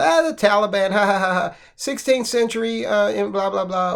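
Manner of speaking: high, dismissive mock laugh running through the words; mocking laughter. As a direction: imitate scoffers laughing it off, pitch sliding down. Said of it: "we laugh at the Taliban".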